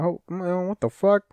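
high, baffled what the fuck; exasperated disbelief. Real, not acted. Complaining to viewers about detectives solving cases in an afternoon, baffled. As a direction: throw up your hands in disbelief.

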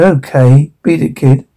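ordinary delivery

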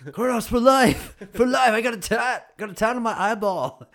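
loud, high rallying cry; mock die-hard hype. Host joking he is Guardhouse for life, hammed-up loud hype. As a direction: shout it like a die-hard fan, easing off at the end. Hyping loyalty to the gym.

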